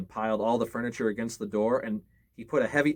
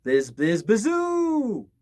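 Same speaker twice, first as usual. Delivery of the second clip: high, loud wind howl rising on bazoo; eerie wind whoosh; 10 semitones higher, 6 dB louder, much slower than usual. A narrator's reading. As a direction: moan it like wind in the cracks. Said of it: "the sound of the wind blowing".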